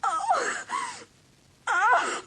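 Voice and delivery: high, breaking sobs of Hallie; crying. Acted. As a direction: let the tears break through each Oh. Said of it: "waited for Hallie to stop crying".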